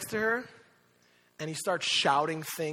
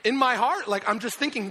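ordinary talk, nothing marked

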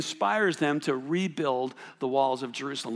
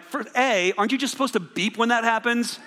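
frustrated; 6 semitones higher than usual. Retold or imitated. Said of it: "I'm like".

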